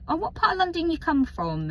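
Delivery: high, quick mock question; imitating curious people. People picking up on her accent, high quick question. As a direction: pitch it right up and ask briskly. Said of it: "people be like".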